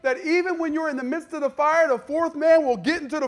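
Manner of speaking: very high, very loud impassioned sermon shout; full-force preaching. Real, not acted. Preacher to congregation in the fire, full-force shouted preaching. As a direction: drive the promise home to the congregation at the top of your voice.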